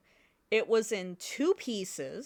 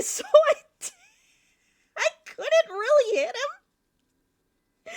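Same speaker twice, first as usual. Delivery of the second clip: laughing; 9 dB louder than usual. Real, not acted. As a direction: crack up mid-sentence. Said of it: "laughing at own story".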